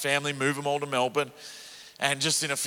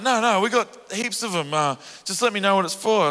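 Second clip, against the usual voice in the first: mother's voice; 7 semitones higher, 5 dB louder than usual. Retold or imitated.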